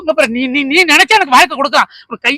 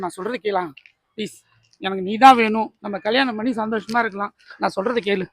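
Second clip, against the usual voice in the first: soft begging; 4 semitones lower, 8 dB quieter than usual. Acted. Final pleading to marry him.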